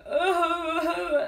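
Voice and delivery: mock sob. Recalling crying while driving to work, mock sob. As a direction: act out crying in the car.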